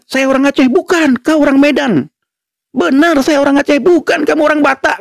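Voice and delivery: put-on childish high squeaky argument, half laughing; mock angry squabble. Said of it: "Mereka marah".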